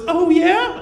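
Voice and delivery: high, intrigued mock girlfriend; a lightly voiced interested girlfriend; girlfriend's voice.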